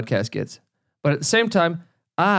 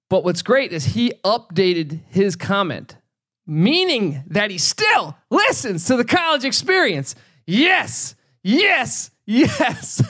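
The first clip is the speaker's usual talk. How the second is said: high, gleeful yes yes yes; triumphant high-pitched glee; gleeful excitement; 6 semitones higher, slower than usual. Real, not acted. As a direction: crow with glee. Gleeful about updated comment.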